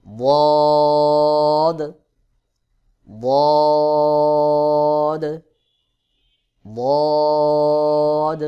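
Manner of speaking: বদ as a loud, long Arabic daad; letter demo. Shown as a demonstration. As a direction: hold বদ, tongue edge pressed on upper molars. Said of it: "গোড়ার কিনারা উপরের মাড়ির". (জিহ্বার কিনারা মাড়ির দাঁতে, জোরে টেনে বদ)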